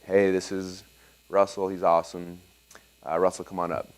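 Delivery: low, soft, deadpan announcer; dry Bill impression. Mentor introducing the speaker to a half-asleep crowd, deadpan and low. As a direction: introduce him with zero energy, bored. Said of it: "he's very dry and very like".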